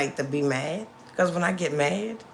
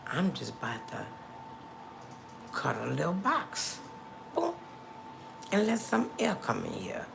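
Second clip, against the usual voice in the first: softer, lower, unbothered quote; acted easygoing reply; 3 semitones lower, 9 dB quieter than usual. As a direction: play it low-key and content. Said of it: "I'll be like".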